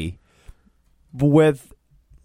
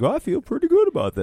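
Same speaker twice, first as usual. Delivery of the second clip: mock pleased actor, pitched much higher; Ben Cross, pleased; 11 semitones higher than usual. Imagined actor judging his own film, mock contentment. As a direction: imitate self-satisfaction in a high voice.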